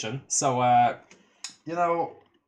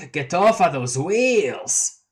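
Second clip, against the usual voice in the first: slow, louder and higher; grand clown proclamation; 4 semitones higher, 4 dB louder, much slower than usual. Acted. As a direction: declare it like a prophet. Gamzee's climactic command.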